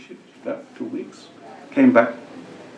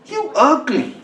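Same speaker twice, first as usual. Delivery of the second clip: squawky high parrot taunt; mock a rude parrot; higher parrot voice; 7 semitones higher, 3 dB quieter, slower than usual.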